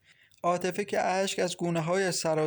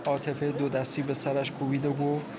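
usual speaking voice

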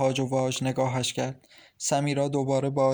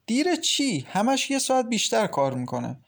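startled, much higher retort; surprised mother; 8 semitones higher than usual. Acted. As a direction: brush off the claim in disbelief. Mother dismissing daughter's worry. (مادر متعجب با صدای خیلی زیرتر)

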